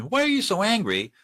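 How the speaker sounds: high-pitched challenge; pointed, worked-up questioning; exasperated demanding question. Shown as a demonstration. Repeats the wrong question.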